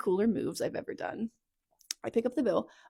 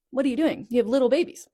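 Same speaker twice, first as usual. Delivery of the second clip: loud, higher-pitched telling-off; retold scolding; 4 semitones higher, 9 dB louder, faster than usual. Telling off sister with little babies, loud exasperated scolding.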